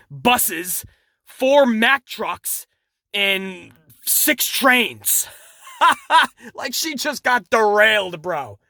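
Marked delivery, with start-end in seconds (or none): 5.8-6.3 short, higher-pitched giggle; amused laugh. Real, not acted. Reviewer amused by his train exaggeration, short laugh. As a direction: crack up briefly at your own joke.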